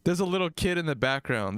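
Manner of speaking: ordinary talk, nothing marked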